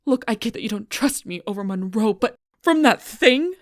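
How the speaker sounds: loud, high shout in anger; angry outburst. Acted. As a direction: yell it in full exasperation. Heated argument erupts.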